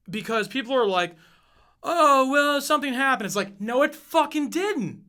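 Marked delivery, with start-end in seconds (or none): 1.8-3.2 mocking excuse. Mocking people's fake excuses.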